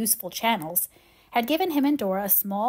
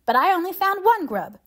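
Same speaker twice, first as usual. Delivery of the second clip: excited; 9 semitones higher, 5 dB louder than usual. A narrator's reading. Davy's excited garden tale.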